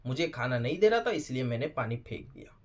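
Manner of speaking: low, quiet mocking repeat; mocking echo. Reviewer repeats Chahat's excuse for throwing water, quietly mocking.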